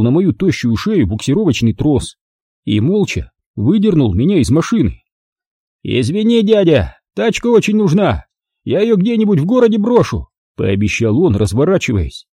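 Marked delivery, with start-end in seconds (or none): 5.8-10.3 put-on higher, breezy apologetic young thug; cheeky thief. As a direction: brush him off with a careless sorry. Car thief apologizing mockingly.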